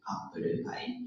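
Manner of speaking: quiet, flat "apple pie" as appuru pai; Japanese English accent. Shown as a demonstration. Teacher repeating the menu word, Japanese English accent. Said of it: "Japanese English is very flat".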